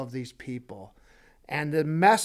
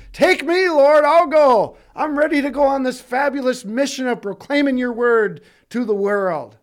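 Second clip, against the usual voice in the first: much higher and louder, bursting with eagerness; eager volunteering; 8 semitones higher, 10 dB louder than usual. Acted. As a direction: shout it up like a thrilled volunteer. Eager Isaiah volunteering to God.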